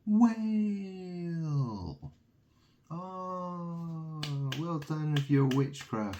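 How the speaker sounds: mock them with sing-song praise; sarcastic mock praise.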